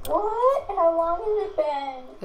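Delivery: high shocked "what"; surprised. Real, not acted. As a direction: jolt up in disbelief. Shocked the video ends.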